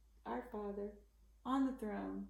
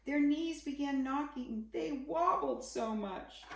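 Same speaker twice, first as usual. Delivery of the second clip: higher, louder, wobbly mock fright; 5 semitones higher, 6 dB louder than usual. A narrator's reading. Reading to kids about scared guards, mock fright. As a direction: act out the guards' shaking knees.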